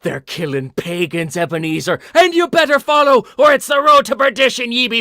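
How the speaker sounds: high, loud ranting Irish ghost; hammy leprechaun brogue, building up. Retold or imitated. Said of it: "And he's like".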